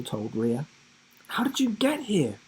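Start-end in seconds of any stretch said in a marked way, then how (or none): 1.3-2.4 surprised. A narrator's reading. Surprised at friend's arrival.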